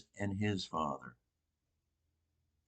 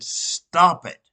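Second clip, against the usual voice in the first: loud, higher angry burst; angry command; 6 semitones higher, 11 dB louder than usual. Retold or imitated. Preacher quoting Jesus driving out money changers, angry command. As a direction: bring out Jesus's anger in a forceful command. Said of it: "He was angry, justifiably angry".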